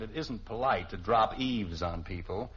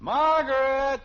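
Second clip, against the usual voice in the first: loud call, higher; 11 semitones higher, 8 dB louder, slower than usual. Real, not acted. Husband calling his wife from upstairs, loud. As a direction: shout her name across the house.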